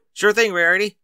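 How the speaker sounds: eager yell toward the door. A narrator's reading. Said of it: "he yelled".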